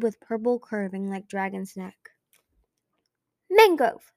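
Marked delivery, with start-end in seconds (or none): 3.5-4.1 sharp loud high bark. A narrator's reading. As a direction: bark the name abruptly. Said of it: "the tendril barked abruptly in Glory's voice".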